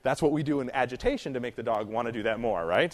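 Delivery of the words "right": ordinary delivery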